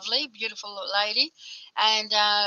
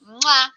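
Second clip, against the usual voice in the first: big wet smooch; 4 semitones higher, 7 dB louder than usual. Real, not acted. Blowing a kiss goodbye.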